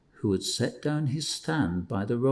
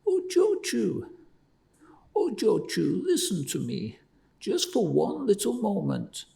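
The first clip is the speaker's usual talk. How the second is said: pleading; 11 semitones higher than usual. A narrator's reading. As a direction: plead with her over and over.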